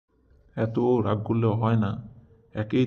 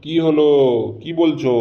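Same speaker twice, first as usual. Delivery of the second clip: loud, higher-pitched answering call; raised voice, stretched endings; calling back; 4 semitones higher, 8 dB louder than usual. Acted. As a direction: shout back across the house. Husband calls back to wife.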